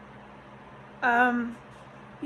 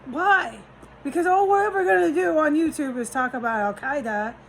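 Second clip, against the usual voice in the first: higher and louder, fed-up tirade; heated rant; 6 semitones higher, 4 dB louder than usual. Real, not acted. Scolding viewers about what YouTube talks about, in a high fed-up rant. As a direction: vent it all at them.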